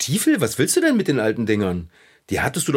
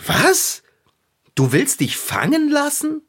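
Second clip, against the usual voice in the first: much higher, startled outburst; shocked disbelief; 12 semitones higher, slower than usual. Acted. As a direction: jolt up in disbelief. Friend shocked at cat's plan.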